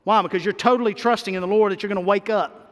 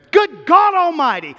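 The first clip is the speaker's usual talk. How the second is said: fervent exclamation; 6 semitones higher, 7 dB louder than usual. Acted. Preacher exclamation.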